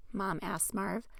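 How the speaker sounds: ordinary delivery, nothing marked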